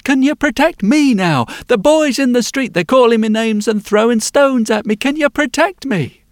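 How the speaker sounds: put-on a small girl asking for help again; pleading little girl. Girl asking blacksmith to save her from boys, high plea.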